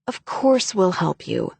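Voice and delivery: ordinary delivery, nothing marked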